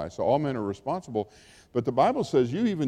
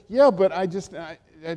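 reluctant excuse; 4 semitones higher, 3 dB louder than usual. Acted. Voicing a reluctant believer's excuse.

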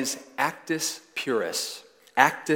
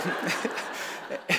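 breathy laugh; 6 semitones higher, 4 dB quieter than usual. Real, not acted. Laughing at his own story.

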